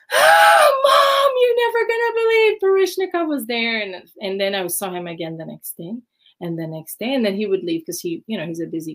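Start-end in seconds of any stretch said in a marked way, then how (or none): 0.1-3.8 very high, loud, almost crying shout to Mom; teen screaming the big news to her mom; excited shout. Retold or imitated. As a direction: burst through the door yelling the news. Bursting home to tell mom.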